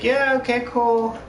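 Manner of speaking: high-pitched mock "okay, cool"; acted casual agreement. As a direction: pitch it way up, playing along.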